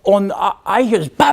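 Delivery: normal talking voice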